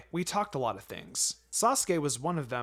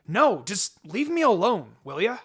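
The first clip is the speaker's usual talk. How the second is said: put-on sulky boy; 6 semitones higher, 8 dB louder than usual. A narrator's reading. Hungry boy refusing his sister's offer, loud sulky brush-off. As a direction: push her away like a stubborn kid.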